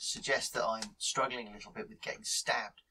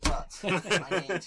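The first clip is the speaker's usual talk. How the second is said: laughing; 6 semitones higher, 5 dB louder, much slower than usual. Real, not acted. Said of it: "Excuse me. I'm sorry".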